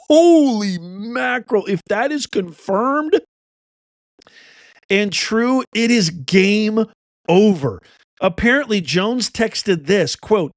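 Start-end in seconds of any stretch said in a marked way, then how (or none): 0.1-7.8 high, slow, drawn-out amazement; astonished. Real, not acted. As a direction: stretch it out, stunned by the revelation. Host reacts to damning allegation.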